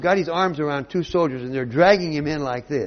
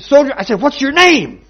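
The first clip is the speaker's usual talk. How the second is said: high, loud furious demand; enraged; 13 semitones higher, 15 dB louder than usual. Acted. Alexander in a rage at the soldier, a high loud demand. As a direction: roar it in a rage. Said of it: "went into a rage".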